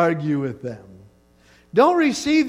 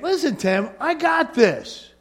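slow, higher, lightly voiced reassuring God; God speaking; 7 semitones higher, slower than usual. God calming Tim down, slow and higher. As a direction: lean back like God has it handled. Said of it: "God's saying".

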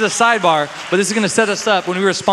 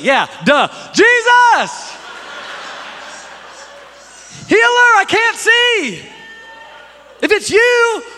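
high, loud desperate cry out to Jesus; Bartimaeus shouting; 5 dB louder, much slower than usual. Retold or imitated. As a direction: scream his name across the crowd, desperate. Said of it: "Bartimaeus is like".

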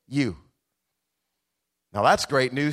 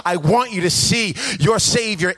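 cheering on; 6 semitones higher, 4 dB louder, slower than usual. Retold or imitated. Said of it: "in the stands cheering you on".

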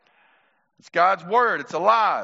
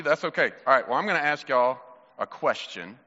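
ordinary talk

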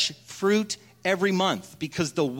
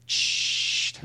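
hush. Acted. Bartender hushes the crowd.

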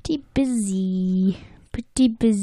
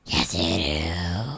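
troll voice; 3 dB quieter, slower than usual. Pretend. Teasing that Scots sound like trolls.

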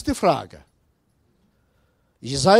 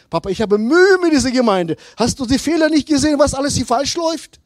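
higher, fast indignant complaint; complaining son; 6 semitones higher, 3 dB louder, faster than usual. Retold or imitated. Son on the phone complaining to his father about the church, fast and indignant. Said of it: "und sagte".

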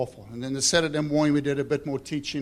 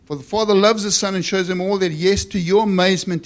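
loud, raised preacher's reading; forceful scripture reading; 6 semitones higher, 9 dB louder than usual. Acted. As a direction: proclaim the verse with force.

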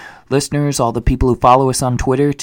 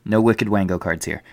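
ordinary delivery

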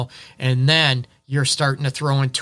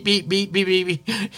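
a quick run of bleep noises, a little higher; mock censor bleeps; 4 semitones higher than usual. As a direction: bleep out the swearing yourself, playfully. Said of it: "I might talk a lot".

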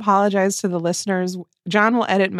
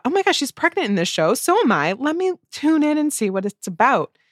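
excited; 7 semitones higher than usual. Retold or imitated. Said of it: "And I thought".

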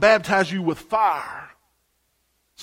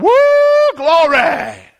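high, loud whoop of joy; excited church shout; 11 semitones higher, 11 dB louder, much slower than usual. Retold or imitated. Old church lady whooping in the Spirit, loud and high. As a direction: shout it out like a sister catching the Spirit.

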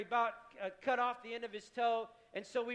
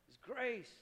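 "His grace" repeated softer still, almost breathed; hushed echo; 6 dB quieter than usual. Real, not acted.

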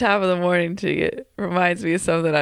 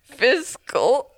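usual speaking voice

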